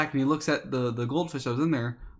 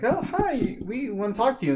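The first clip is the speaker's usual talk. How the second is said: put-on much higher, sweet kid voice; little kid voice; 9 semitones higher than usual. Little kids trying to talk to the popular girls. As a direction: chirp it like an eager sixth grader.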